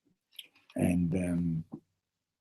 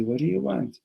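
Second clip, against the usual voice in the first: high-pitched mock exasperation; fed-up question to a pest, acted; exasperated; 9 semitones higher than usual. Drinkers interrupted a third time, exasperated.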